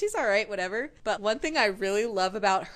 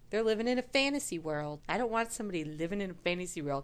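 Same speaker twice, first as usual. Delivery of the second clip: ordinary talk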